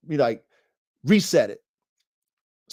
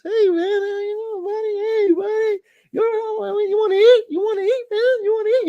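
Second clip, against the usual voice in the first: very high cooing dog voice; squeaky high baby talk to a pet. Retold or imitated.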